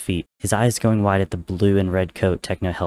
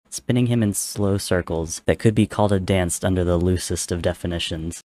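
ordinary delivery, nothing marked

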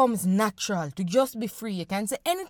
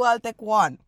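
ordinary delivery

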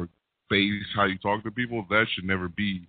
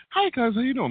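chirpy upward inflection. Shown as a demonstration. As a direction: lift every word up in a cheerful front. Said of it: "I put that upward inflection".

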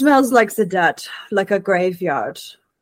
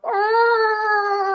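high whining dog sound; dog whimper. Acted. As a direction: whimper like a sad puppy. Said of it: "look at the rest of the group and go".

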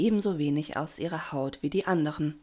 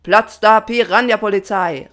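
loud, raised, furious motto; angry call; 5 semitones higher, 13 dB louder than usual. A narrator's reading. Papa dismisses the swarm, shouting quite angrily. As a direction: bellow it crossly. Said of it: "rief Papa Patron ziemlich wütend".

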